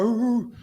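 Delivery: short high barking hoo, hoo; dog bark imitation. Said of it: "you ever heard a dog bark?".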